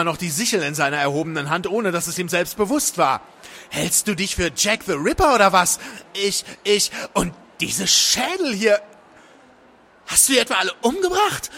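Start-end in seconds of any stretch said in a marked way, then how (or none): 3.7-11.5 high, agitated accusations; alarmed, angry woman. A narrator's reading. Woman to man with sickle and skulls, horrified accusation. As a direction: stumble from outrage into horrified questioning.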